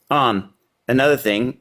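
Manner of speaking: usual speaking voice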